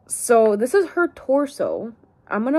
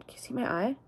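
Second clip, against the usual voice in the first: usual speaking voice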